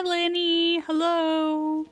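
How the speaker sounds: ordinary delivery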